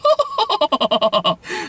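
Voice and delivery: high-pitched fake laughing; hammed-up parody cackle; mock laugh. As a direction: put on a long, high, silly laugh. Fake laugh inside the trailer parody.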